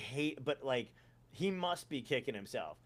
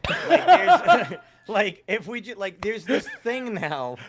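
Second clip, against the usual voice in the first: laughing; 5 semitones higher, 11 dB louder, slower than usual. Real, not acted. Laughing while ranting.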